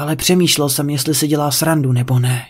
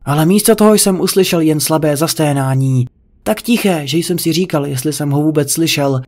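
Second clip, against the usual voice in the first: usual speaking voice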